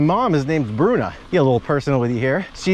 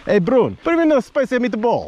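pitched-up Italian-American uncle calling for meatballs; imitating a boisterous Italian uncle; Uncle Enrico; 9 semitones higher than usual. Nephew recalls Uncle Enrico calling to his mom, Italian-American. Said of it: "You'd hear Uncle Enrico go".

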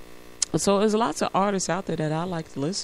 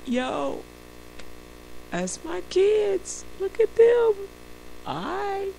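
playful proud Jesus; 11 semitones higher, much slower than usual. Pretend. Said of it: "Imagine Jesus".

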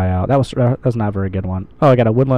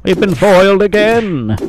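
high, slow, hammed-up villain's lament; mock foiled villain; 10 semitones higher, 4 dB louder than usual. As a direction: wail it out like a thwarted cartoon villain. Mock villain line, bigger take.

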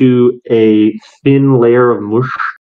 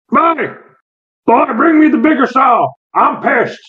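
put-on an irritated old man hollering across the yard; angry yell; 11 semitones higher than usual. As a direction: bellow at the boy, pitched way up and pissed off. NPC yelling for a saw.

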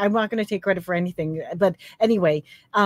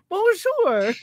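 laughing bonjour; 11 semitones higher than usual. Pretend. Joking French greeting, laughing.

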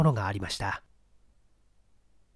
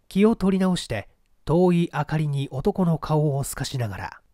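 usual speaking voice

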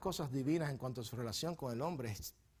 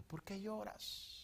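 soft question; 5 semitones higher, 13 dB quieter, much slower than usual. Acted. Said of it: "la gran pregunta".

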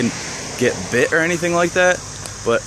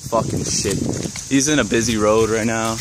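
normal talking voice

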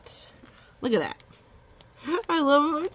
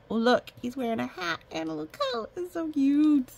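high, quick gushing over cuteness; 8 semitones higher than usual. Real, not acted. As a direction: coo over something adorable. Gushing over cute picture.

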